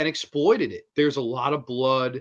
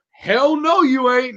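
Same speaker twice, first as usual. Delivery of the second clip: higher, louder "hell no, you ain't"; mock fierce Ethel; 10 semitones higher, 8 dB louder, slower than usual. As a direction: bark it out like Ethel going in for the kill. Voicing Ethel attacking.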